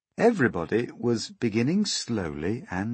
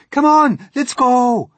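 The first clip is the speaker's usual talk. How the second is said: high, loud, eager urging; excited high cries; chorused shout; 15 dB louder, slower than usual. A narrator's reading. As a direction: yell it as a crowd raring to go. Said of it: "they chorused".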